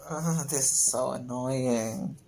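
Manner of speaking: normal talking voice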